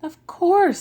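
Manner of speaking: high and loud, a burst of eager reassurance; Large's high, loud reply to Small; emphatic reassurance. Acted. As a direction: sing out the answer to reassure the child. Large answers Small warmly.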